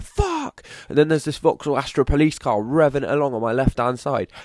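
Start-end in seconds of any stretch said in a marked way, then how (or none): 0.0-0.6 retold yelp. Gamer retelling near crash, high mock yelp. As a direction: squeak it out as you relive the fright. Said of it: "I'm like".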